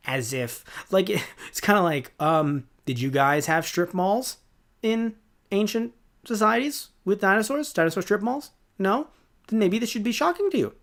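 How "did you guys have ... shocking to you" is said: high, leading questions, mildly incredulous; pitched-up faux-innocent questioning; mock-puzzled questioning. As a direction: quiz them with rising mock confusion. Mock-questioning characters about strip malls.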